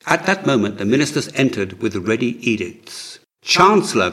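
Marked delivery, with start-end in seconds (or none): 3.4-4.1 higher, louder cry for help. A narrator's reading. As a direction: call out to him in alarm. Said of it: "cried Horus".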